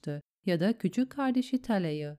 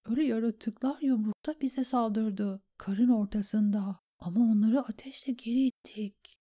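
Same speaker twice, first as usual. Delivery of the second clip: higher, half-whispered dispatch; written message voice; 4 semitones higher than usual. A narrator's reading. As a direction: whisper the note as you write. Sam writes battle message. (fısıltılı not okuması)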